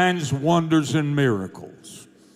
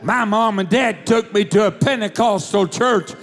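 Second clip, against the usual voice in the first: fiery preaching; 4 semitones higher than usual. Acted. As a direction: preach it to the back row. Preaches at full force.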